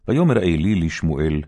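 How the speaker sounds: usual speaking voice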